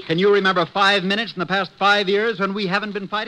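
husband snapping at wife; angry husband. Acted. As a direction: pick the fight. Married couple quarrel.

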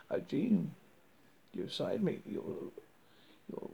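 ordinary delivery

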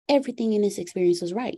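ordinary talk, nothing marked

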